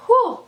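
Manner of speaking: high, loud exhaled whew; relieved whew. Real, not acted. Vlogger steadying herself after nearly crying, a relieved whew. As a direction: blow out the emotion in one high whew.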